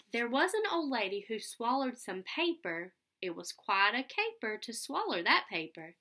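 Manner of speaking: lively read-aloud storytelling voice; sing-song picture book reading; reading to children. Acted. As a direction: read it out with bounce for little kids.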